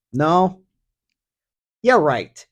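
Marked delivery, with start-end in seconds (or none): none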